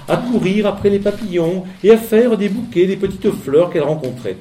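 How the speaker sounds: ordinary delivery, nothing marked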